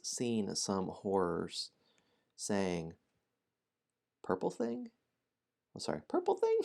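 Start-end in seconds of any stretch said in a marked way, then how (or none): 4.2-6.6 high squeaky gnome voice, baffled; a confused gnome repeats the hero's words; puzzled gnome. Acted. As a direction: squeak the question back in disbelief.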